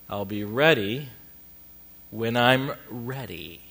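slow, every syllable spelled out; clear enunciation. Retold or imitated. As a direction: imitate a wife putting her foot down, slowly and distinctly. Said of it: "Notice the clear enunciation of every syllable".

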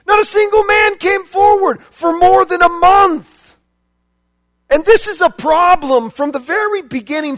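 loud fervent preaching; preaching at full force. Acted. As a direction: shout the challenge at the church. Fiery preaching climax.

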